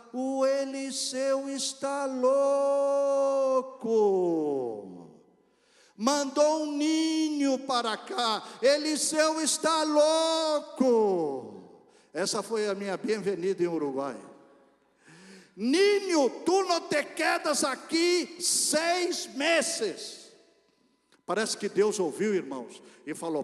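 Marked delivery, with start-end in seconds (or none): 0.1-11.5 put-on slow, drawn-out, louder scornful voice of an old worker; mocking Uruguayan worker. Worker greets the newly arrived missionary with slow loud scorn. 15.6-20.3 put-on slow, drawn-out, louder scornful voice of an old worker; mocking Uruguayan worker. Worker greets the newly arrived missionary with slow loud scorn. Worker predicts he won't stay.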